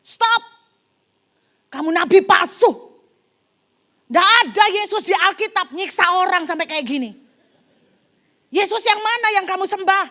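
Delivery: angry shouting crowd. Retold or imitated. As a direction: yell at the false prophet in outrage. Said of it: "Jemaat bilang begini". (jemaat berteriak marah)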